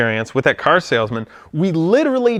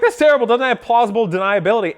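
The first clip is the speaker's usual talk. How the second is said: mock outrage; 6 semitones higher, faster than usual. Repeats the joke in retelling.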